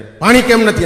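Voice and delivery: retired military man's angry yell, high and loud; hot-tempered shout. Retold or imitated. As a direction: bellow it at the household like an irritable ex-soldier. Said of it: "રાડા રાડ કરો માં તમે". (ગરમ મગજના ફોજીની રાડ)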